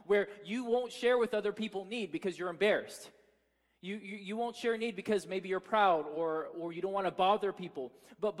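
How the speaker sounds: loud, driving sermon appeal; full-force preaching. Acted. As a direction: press the congregation hard.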